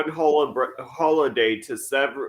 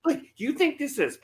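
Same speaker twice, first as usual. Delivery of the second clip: high, rising disbelief, retold; mock incredulous mom; 7 semitones higher than usual. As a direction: imitate a mother appalled at the laughter. Voices mother appalled at his laughing.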